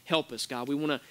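soft, low, hushed praying; earnest prayer. Acted. Pastor praying for the congregation, soft and earnest. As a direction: pray quietly and sincerely.

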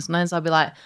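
ordinary talk, nothing marked

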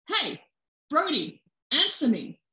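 high, loud, furious call; angry shout. A narrator's reading. Officer shouting at her silent partner, angry and loud. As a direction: make him answer you, now.

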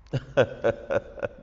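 low, voiceless run of laughter; breaking into laughter. Real, not acted. Host cracks up telling her story to the pastor, laughing.

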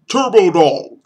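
high, loud superhero announcer voice; crime fighter announcer. Pretend. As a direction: boom it like a hero's name. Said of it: "Sounds like a crime fighter".

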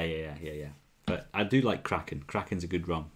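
normal talking voice

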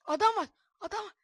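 high, sharp urgent call; alarmed warning. Real, not acted. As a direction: warn the friend of an enemy. Rakibi görünce uyarı. (telaşlı uyarı)

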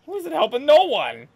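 outraged rant. Acted. Ranting at guard in game.

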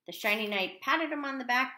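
ordinary delivery

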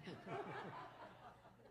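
soft helpless laughter; giggling at a dark joke; real laugh. Real, not acted. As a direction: laugh quietly at the memory. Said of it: "I thought that was really funny".

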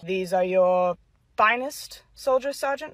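low and unhurried authority; stern colonel. Acted. Colonel inspecting troops.

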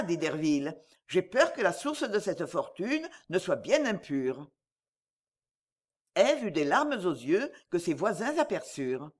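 ordinary delivery

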